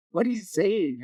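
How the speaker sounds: put-on high, puzzled little-boy voice; a touch of a bewildered toddler; puzzled kid. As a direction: ask it like a confused child. Nephew puzzled by the language.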